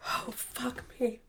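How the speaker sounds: breathless strained whisper; hurting too much to voice it; pained whisper. Real, not acted. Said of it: "Laughing hurts".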